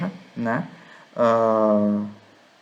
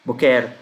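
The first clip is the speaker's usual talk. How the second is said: "Boquer" stressed on the last syllable, open e; "Boquer" with the weight on "quer"; mistaken final stress. Shown as a demonstration. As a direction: say "Boquer" as bo-KÉR. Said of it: "algumas pessoas poderiam ler isso aqui como boquer".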